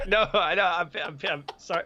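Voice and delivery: laughing through the words; chuckling reply. Real, not acted.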